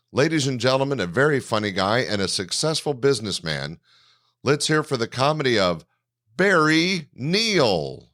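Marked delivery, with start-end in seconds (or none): 4.4-8.1 stage introduction. Real, not acted. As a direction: announce the headliner with a rising, stretched name. Host introduces the comedian.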